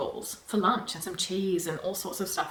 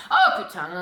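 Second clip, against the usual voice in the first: loud exasperated French curse; sudden irritated outburst; worked up curse; 9 semitones higher, 7 dB louder than usual. Retold or imitated. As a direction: blurt it out in frustration. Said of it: "I'm getting worked up".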